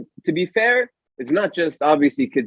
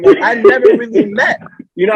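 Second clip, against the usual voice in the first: laughing; 10 semitones higher, 6 dB louder than usual. Real, not acted. Laughing while telling story.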